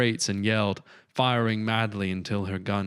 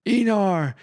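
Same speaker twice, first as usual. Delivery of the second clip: a loud, much higher cry with a falling end; anguished yell; 12 semitones higher, 6 dB louder than usual. A narrator's reading. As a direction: cry his name as he falls. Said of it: "yelled Rowan, her eyes wet with tears".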